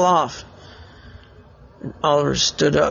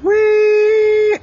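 very high, loud whistle sound; imitated whistle; 5 dB louder than usual. The ref whistle stopping the play, a piercing high imitated whistle. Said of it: "a whistle went".

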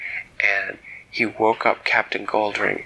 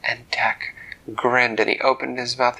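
plain reading voice

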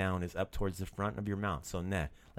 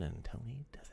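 whisper; 3 semitones higher, 10 dB quieter than usual. Real, not acted. Teasing aside about Tony.

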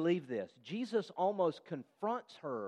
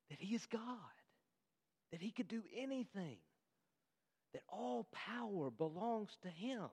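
hushed awe; 4 semitones higher, 9 dB quieter than usual. Real, not acted. Awe at Jesus' divinity.

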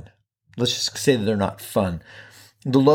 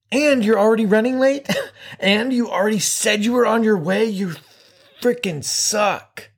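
slow, higher, mock-harsh inner critic; self-loathing inner voice; 8 semitones higher, slower than usual. Host voicing his inner critic scolding himself, mock harsh. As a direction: berate yourself like your own worst enemy. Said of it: "has become".

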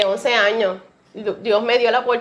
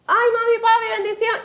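high, bright put-on cheer to hide tears; much higher mock-cheerful hello; fake cheerful greeting; 11 semitones higher than usual. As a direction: greet parents as if nothing happened. Faking cheer after crying.

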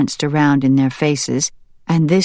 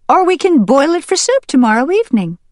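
very high, fast, louder wife voice; farmer's wife; 13 semitones higher, 5 dB louder, faster than usual. Acted. Farmer's wife proposing soup to the farmer, high and fast. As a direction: suggest it brightly, quickly. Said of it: "the farmer's wife wondered".

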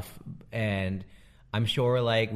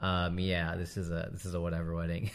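low, quiet, unimpressed; bored mock reply, dropping in pitch; jaded wedding veteran; 4 semitones lower, 4 dB quieter than usual. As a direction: shrug it off, seen it all. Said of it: "those of us who have actually been through this a thousand times are like".

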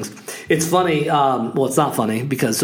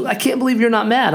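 higher-pitched imitation of an upset woman; retold upset lady; 9 semitones higher than usual. Lady who dented the car to its owner, imitated upset woman. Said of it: "you know, all upset, crying a little bit".

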